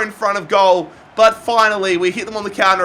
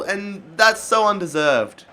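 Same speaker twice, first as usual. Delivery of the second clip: normal talking voice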